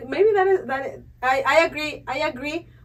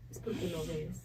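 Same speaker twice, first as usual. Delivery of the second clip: mom's voice; 11 dB quieter, faster than usual. Retold or imitated. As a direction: quietly imitate a mother clucking over her. Mom's saying repeated.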